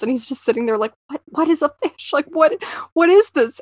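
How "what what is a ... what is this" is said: high, flustered mock panic. Imitating Casey's panicked confusion, higher and flustered. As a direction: play the confused clerk, rattled. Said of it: "he just, like, is panicking".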